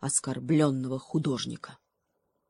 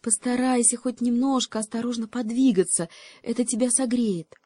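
higher, gentle bird voice; crow advising; 7 semitones higher than usual. Acted. Crow urging the monkey to move, higher voice. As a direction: coax her to move.

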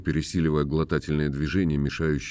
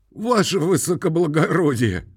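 rattling falsetto; 10 semitones higher than usual. A narrator's reading. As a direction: cry out in a quavering falsetto. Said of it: "дребезжащей фистулой, громко". (громкая дребезжащая фистула)

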